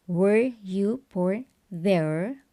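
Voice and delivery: "Were you born there?" said very slowly, stress on "Were", rising at the end; drawn out for learners: "Were you born there?" with a rising tune; slow teaching read-out; slow model question. Shown as a demonstration. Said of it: "passive voice".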